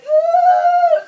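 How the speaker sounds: high, loud drawn-out "ooh"; retold labor howl, high and loud; loud high whoop. Woman pushing out a baby, high loud howl. Imitates noise while pushing.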